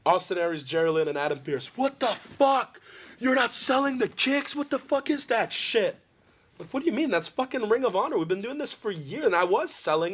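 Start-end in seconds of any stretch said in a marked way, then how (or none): 1.8-6.0 high, slow mock outrage; shrill-free hammed-up yell, pitched way up; mock angry booker. Bookers yelling retold in backstage story. 6.7-9.3 high, incredulous retort; lightly hammed disbelief; mock indignant protest. Retold own protest backstage.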